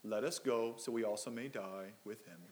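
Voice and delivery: understated, resigned moping with the energy sagging; a hint of a glum, defeated cartoon donkey; Eeyore. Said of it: "in his best Eeyore voice".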